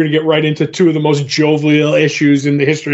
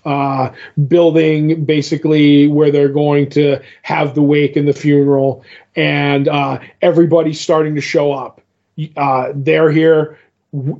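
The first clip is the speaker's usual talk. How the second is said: usual speaking voice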